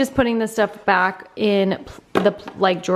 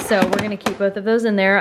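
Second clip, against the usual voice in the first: ordinary talk, nothing marked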